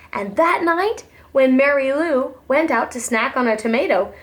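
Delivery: ordinary delivery, nothing marked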